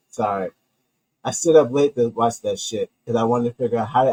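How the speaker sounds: ordinary talk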